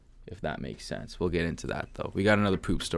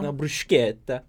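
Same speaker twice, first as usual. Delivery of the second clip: Italian bruschetta; 6 semitones higher, 4 dB louder than usual. Shown as a demonstration. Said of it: "It needs a little bruschetta".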